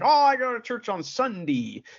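a hint of old-timer Ohio accent; old Ohioan. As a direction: answer like an elderly Ohio local, lightly. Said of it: "old person that lives in Ohio".

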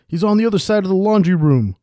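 high, loud calling shout; yelled from upstairs. A narrator's reading. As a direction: yell down to the others from a floor above. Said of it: "one of the guards yelled from the second floor".

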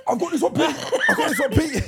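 high, loud, laughing hero line; a hint of a hammy superhero; Captain Stink voice.

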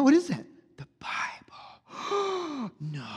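slow, hushed stage whisper with a gasp; hammed-up breath-caught wonder; mock astonished discovery. Acts out astonished discovery of Bible.

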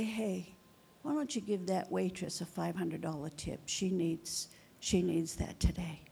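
soft whisper of God. Retold or imitated. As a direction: murmur it like a nudge in the ear. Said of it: "God can whisper in your ear".

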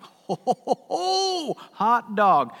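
gloating ho ho ho, jumping up high; gleeful chuckle. Acted. Early workers gloating over coming pay, gleeful chuckle. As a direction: chuckle like workers expecting a bonus. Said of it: "rubbing their hands together like".

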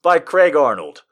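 excited title. Real, not acted. Announces title with flourish.

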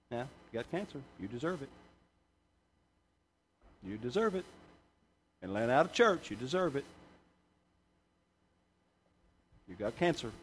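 low, soft, accusing voice telling her "you deserve it"; a hushed taunting thought in her head; quiet, condemning inner voice. Retold or imitated. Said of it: "a voice said to her, in her own voice".

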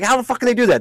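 high-pitched exasperated question; retold pissed-off disbelief. Fan reliving the holding call, pissed off. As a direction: demand it in indignant disbelief. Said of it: "So I was, like, pissed off".

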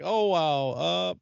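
slow, unimpressed deadpan; sarcastic. Pretend. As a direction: drag it out, not impressed. Bored mock reaction to messy eater.

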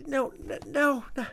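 very high, desperate no; panicked cries. Acted. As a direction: cry out in terror. Dave learns they met his sister.

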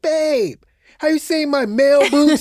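high, loud and fussy; a flustered hurried question; mock Deacon. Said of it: "he was like".

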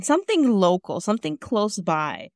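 usual speaking voice

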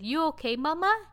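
put-on high-pitched little kid asking; concerned toddler. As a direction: imitate a worried two-year-old checking on mom. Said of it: "my two-year-old, like, came over and said".

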